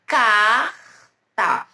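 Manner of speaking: "carta" with a long throaty R, pause, then ta; R e T definidos. Shown as a demonstration. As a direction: punch out "carta" in two clear parts. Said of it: "o som do R e o som do T bem definidos". ("carta" com R bem marcado, pausa, depois o T)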